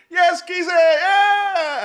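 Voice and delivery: high, loud cheer at a mate; greeting a drunk friend with a big shout; hailing shout. Retold or imitated. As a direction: yell a greeting across the street. Hailing his brother's mate.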